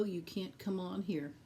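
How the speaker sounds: ordinary delivery, nothing marked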